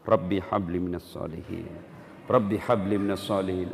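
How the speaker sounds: low, gentle murmured prayer; soft prayer over a child. Shown as a demonstration. Preacher shows parents praying softly over a child. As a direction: pray it softly while stroking the head. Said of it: "Usap-usap kepalanya".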